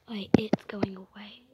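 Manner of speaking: normal talking voice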